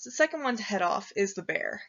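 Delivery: ordinary delivery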